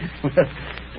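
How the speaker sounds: a soft breathy chuckle; quiet chuckle. Acted. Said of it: "you're chuckling over something now".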